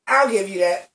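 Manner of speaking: ordinary delivery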